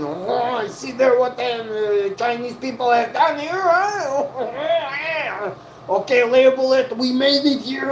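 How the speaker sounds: high, hammed-up French accent sneering at the Chinese makers; over-the-top French accent, rising squeakier to the end; mock French accent.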